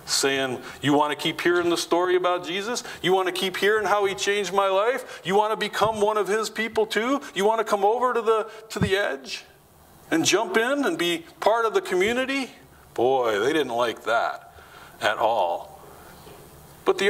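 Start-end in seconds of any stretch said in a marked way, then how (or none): 0.8-12.5 higher-pitched sarcastic taunting, a bit fast at first; sarcastic mocking. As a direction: needle them with mock eagerness. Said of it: "He's sarcastically mocking them".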